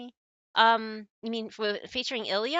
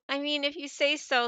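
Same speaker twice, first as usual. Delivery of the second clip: ordinary talk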